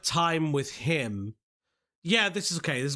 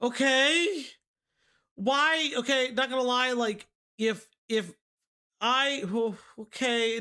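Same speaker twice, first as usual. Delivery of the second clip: shocked disbelief; 8 semitones higher, 3 dB louder, slower than usual. Real, not acted. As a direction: voice jumps much higher, stunned and stammering. Reacting to shocking story detail.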